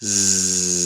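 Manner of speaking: usual speaking voice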